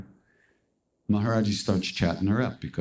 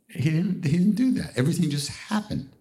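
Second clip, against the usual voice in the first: amused emphasis; 9 semitones higher than usual. Real, not acted. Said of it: "laughing emphasis on teaching way".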